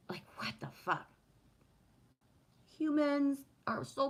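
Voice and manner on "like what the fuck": breathy whisper of disbelief; whispered what the fuck. Real, not acted. Whispering to viewers about impatient drivers, baffled.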